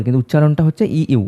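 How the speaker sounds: usual speaking voice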